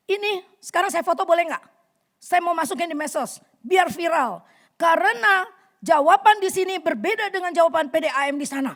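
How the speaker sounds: loud, high threatening complaint; angry threat. Retold or imitated. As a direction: threaten to make it go viral. Angry woman threatens to post. (ancaman marah dengan suara keras)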